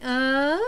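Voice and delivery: "Uh" drawn out, sliding up like a question; long rising "Uh"; questioning rise. Shown as a demonstration. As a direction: slide "Uh" upward to ask. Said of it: "up like that when you ask a question".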